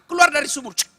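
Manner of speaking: ordinary talk, nothing marked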